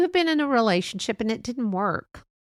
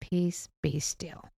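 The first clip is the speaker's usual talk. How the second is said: slow and soft, after a deep breath; calm, slow peace; 8 dB quieter, slower than usual. Shown as a demonstration. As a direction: breathe out and say it gently, slowly. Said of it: "take a deep breath and say".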